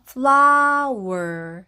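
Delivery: "flower" çok yavaş ve heceler birleşik; English flower. Shown as a demonstration. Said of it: "flower 이렇게 발음하시면 됩니다".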